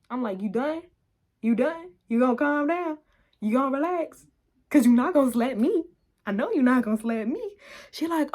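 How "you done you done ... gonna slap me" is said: raised, challenging questions; acted confrontation. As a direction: re-enact laying down the law, voice raised. Standing over pinned girl, demanding.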